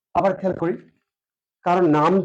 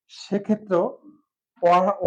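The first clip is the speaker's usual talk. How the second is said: ordinary talk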